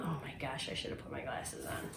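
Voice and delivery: low, soft, quick whisper to herself; muttered aside. Real, not acted. Scolding herself for forgetting glasses, muttered quietly. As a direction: mutter it under your breath. Self-remark while squinting.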